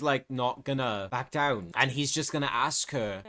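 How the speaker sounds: ordinary delivery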